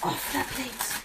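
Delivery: slow hushed echo; slow soft echo. Pretend. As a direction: echo it faintly and slowly. Repeated softly.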